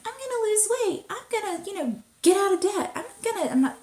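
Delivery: high, sing-song mock resolutions. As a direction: parody grand New Year's promises. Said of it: "I'm not saying".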